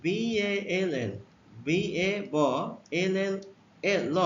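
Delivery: drawn-out letter by letter বিএএলএল; slow spelling. Shown as a demonstration. Teacher spelling বিএএলএল slowly for young learners. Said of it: "এর উচ্চারণ অ হলে".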